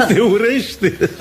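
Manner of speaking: long, high peal of laughter; high-pitched, drawn-out laughing question; amused disbelief. Acted. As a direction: laugh hard, then ask it slowly through laughter. Laughs at girl's claim of hatred.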